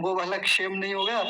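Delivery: ordinary talk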